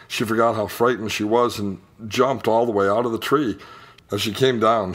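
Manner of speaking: ordinary delivery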